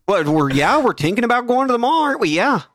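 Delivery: mock-Canadian, every phrase lifted into a question; Canadian accent. Shown as a demonstration. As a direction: exaggerate the Canadian uptalk. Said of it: "Everything has to be a question".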